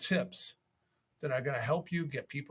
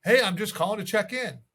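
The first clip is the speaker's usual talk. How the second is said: louder, brighter mock salesman check-in; mock pushy caller; 4 semitones higher, 8 dB louder than usual. Agent mocking lazy callers: loud, hammed-up check-in. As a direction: toss it off like a careless cold caller. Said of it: "a lot of people call and they say".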